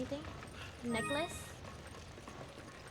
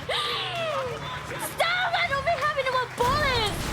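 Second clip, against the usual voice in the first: high panicked yell; panicked shout; 10 semitones higher, slower than usual. Real, not acted. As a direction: scream stop in real panic. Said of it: "a state of panic".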